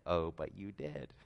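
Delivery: low, soft, slow reply; knowing angel. Retold or imitated. Angel answering Sarah's denial, low and soft. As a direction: drop low and quiet, knowingly. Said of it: "And the angel said".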